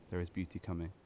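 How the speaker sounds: soft, hushed repeat; quiet echo. Acted. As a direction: let it fade like an afterthought.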